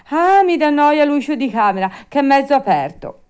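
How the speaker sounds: loud, high puppet grumble; Pinocchio, peevish. Acted. Puppet complains to the fairy about the door, peevish. Puppet finds another excuse.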